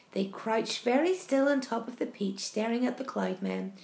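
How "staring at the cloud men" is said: usual speaking voice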